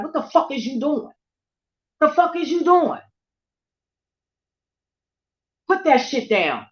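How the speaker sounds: high, loud outraged yell; retold scream. Yelling at a kid throwing a bottle, outraged scream. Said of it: "that's when I scream".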